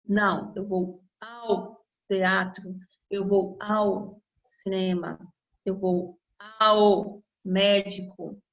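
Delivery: each "ao" drawn out, slow and low; slow correct forms. Shown as a demonstration. Teacher slowly modelling "ao" after the wrong "a cinema". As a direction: spell out the correct examples slowly. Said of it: "correct forms slowly".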